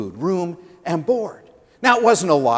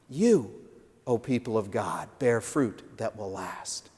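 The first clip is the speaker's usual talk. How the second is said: hushed solemn charge; 6 semitones lower, 9 dB quieter than usual. Acted. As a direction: let it land gently as a blessing. Closing repeat of the charge.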